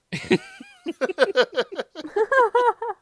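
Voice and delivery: long, high peal of laughter; real uncontrolled cackling; loud laugh. Real, not acted. Laughing at the Shrek joke.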